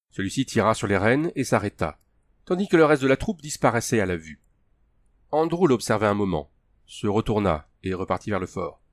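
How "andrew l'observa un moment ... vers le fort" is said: usual speaking voice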